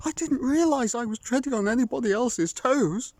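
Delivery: high, fast stammering apology; a scared fox babbling sorry; high nervous stammer; frightened fox. Acted.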